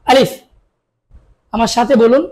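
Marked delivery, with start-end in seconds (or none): 0.1-0.3 আলিফ said firmly, a strong clear a; a firm demo of আলিফ; firm alif. Shown as a demonstration. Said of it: "শক্ত করে আদায় করতে হবে". (আলিফ শক্ত করে উচ্চারণ)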